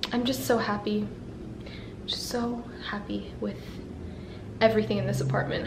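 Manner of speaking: quiet, grateful murmur; soft happy sigh of contentment; soft and content. Real, not acted.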